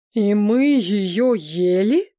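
slow, drawn-out horror; horrified. A narrator's reading. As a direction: drag out the question, appalled. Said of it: "ужаснулся король и сам позеленел". (с ужасом, медленно)

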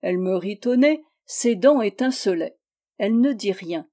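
usual speaking voice

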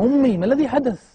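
high, loud, panicked call to his mother; rushed, frightened plea: what happened; alarmed cry. Retold or imitated. Son calling to his fainting mother, high and panicked.

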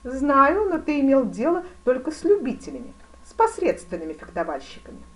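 loud, high imperious grand duke; великий князь, напористый. A narrator's reading. (напористо и громко)

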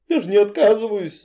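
high, whiny plea; whining. Acted. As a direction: whine it like a scared girl. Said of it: "заныла я".

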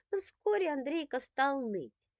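plain reading voice

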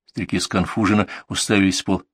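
ordinary delivery

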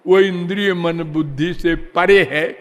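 ordinary delivery

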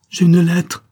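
very high, loud, ringing cry; vibrant voice. A narrator's reading. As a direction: call out the news in ringing excitement. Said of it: "prononcés d'une voix vibrante".

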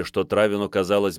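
ordinary delivery

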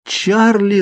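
very high cry of the name; alarmed cry. A narrator's reading. Girl realising her father is in danger, crying out. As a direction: cry out, pitch leaping up. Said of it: "закричал я".

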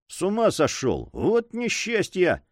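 high, dismayed exclamation; alarmed cry. A narrator's reading. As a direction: lament it high and drawn-out. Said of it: "вскричал Мигель".

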